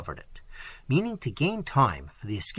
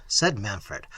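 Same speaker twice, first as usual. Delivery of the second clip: ordinary delivery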